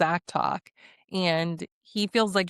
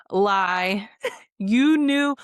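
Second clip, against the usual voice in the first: calling out a lie; 5 dB louder, much slower than usual. Real, not acted. Vlogger calling out a contestant's lie, loud and accusing.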